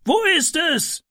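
high call from inside the smoky room; man calling down from the window. A narrator's reading. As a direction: shout down to the people below.

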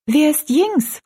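put-on high, lively girl's question; high-voiced, animated child wanting to know; lively curiosity. A narrator's reading. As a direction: ask it brightly curious, pitched well up. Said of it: "wurde ganz lebhaft".

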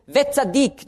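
Wetzadik lifted high; stress on Wetzadik. Shown as a demonstration. Preacher marking the accent in Romans, stress on Wetzadik. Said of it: "jedes Mal wird der Akzent auf ein anderes Wort gelegt".

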